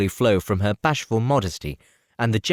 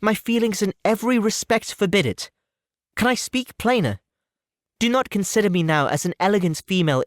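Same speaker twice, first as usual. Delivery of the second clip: put-on young woman's voice, higher, earnest and resolute; a heroine turning down a suitor, higher and firm; Elizabeth, firm refusal; 9 semitones higher than usual. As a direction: refuse him politely but without budging. Elizabeth refusing Mr Collins' proposal.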